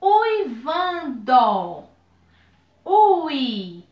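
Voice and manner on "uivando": "Uivando" bem lento, ui-van-do; palavra silabada. Shown as a demonstration. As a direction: diga "Uivando" devagar, destacando o "ui". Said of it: "duas vogais numa mesma sílaba". ("Uivando" silabado para a turma)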